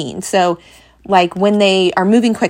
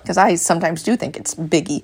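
ordinary delivery

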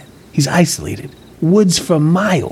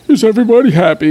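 high, loud, quick showman's call; hammed-up jazz bandleader shout; 8 semitones higher, 5 dB louder, faster than usual. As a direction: imitate a jazz entertainer rallying the crowd. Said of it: "talking some Ted Lewis jazz voice".

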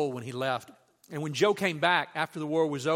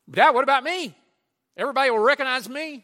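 put-on high, loud and fast little boy; child's voice; 9 semitones higher, 11 dB louder than usual. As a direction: rush it out like a proud kid. Son voiced in retold story.

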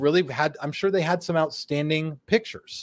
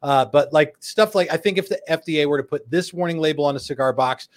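usual speaking voice

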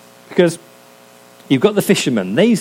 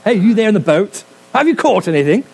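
high, plummy English gent calling out; mock upper-class English accent; posh English accent; 7 semitones higher than usual. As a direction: hail the boat like a posh English gentleman. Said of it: "I'm gonna give him an English accent".

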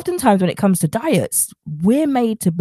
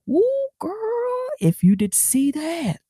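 excited squeal; 11 semitones higher, 5 dB quieter, much slower than usual. Real, not acted. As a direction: gush over it like a proud friend. Showing off her transformation.

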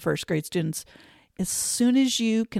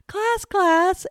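1 calling the class; 12 semitones higher, 4 dB louder than usual. Retold or imitated. Teacher calling class, no answer.

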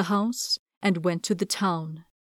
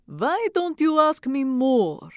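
put-on slow, higher old witch voice; 6 semitones higher than usual. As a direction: question her like a sly old witch. Witch Baba Yaga questions the girl.

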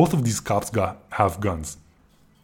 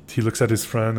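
ordinary talk, nothing marked

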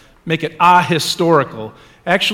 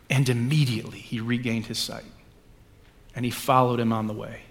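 measured, solemn gospel reading; scripture reading. Acted. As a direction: liturgical reading voice. Reads the gospel passage aloud.

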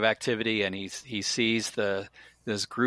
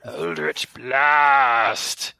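otherworldly booming spell shout; disembodied voice; 2 semitones higher, 7 dB louder, much slower than usual. Acted. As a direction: call the spell out in an eerie voice not your own. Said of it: "Say it, say it".

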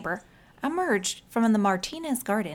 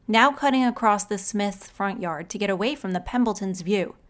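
usual speaking voice

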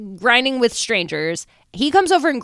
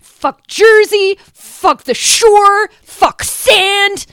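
high, loud, slow mock rant, every word hammered; playing Ronnie storming off in a rage; mock furious rant; 7 semitones higher, 6 dB louder, much slower than usual. Co-host voicing angry Ronnie leaving the club, slow loud hammed-up ranting.

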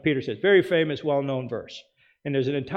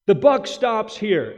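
mock Biden quote; 6 semitones higher, 7 dB louder, slower than usual. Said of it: "about Biden".

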